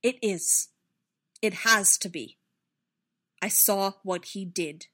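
loud, halting, impassioned confession; anguished insistence. A narrator's reading. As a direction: force out each phrase, swelling with distress. Anguished confession, straining for control.

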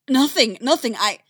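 high, loud panicked denial; terrified pleading. Acted. Character tortured, begging.

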